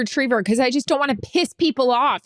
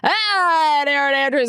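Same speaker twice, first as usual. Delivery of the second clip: higher put-on broadcaster voice tossing to her; imitating Kevin; 4 semitones higher than usual. A colleague's put-on broadcaster voice handing her the live hit. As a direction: mimic the play-by-play man throwing it over. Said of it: "and Kevin's like".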